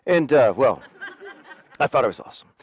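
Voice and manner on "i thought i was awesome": sheepish laughing admission; laughing correction. Real, not acted. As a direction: lower and softer, through a laugh.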